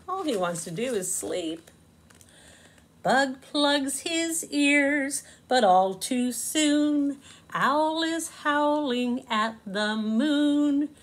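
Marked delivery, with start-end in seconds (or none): 3.0-10.9 slow, lilting storybook narration; reading to children. A narrator's reading. Narrator reading Bug in a Rug to kids, slow and sing-song. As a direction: savour each rhyme for little listeners.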